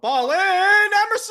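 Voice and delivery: high, loud yell of the player's name; live excited call. Real, not acted. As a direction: call the cross as it happens. Live chance in the match.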